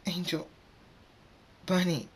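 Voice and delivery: high, halting grief-stricken name; crying. A narrator's reading. Fluttershy drops to her knees crying for Angel Bunny, high halting cry. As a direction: mourn the name in two broken pieces. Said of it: "drops to her knees crying".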